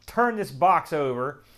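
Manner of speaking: ordinary delivery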